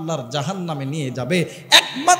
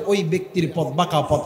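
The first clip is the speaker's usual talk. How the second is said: ordinary delivery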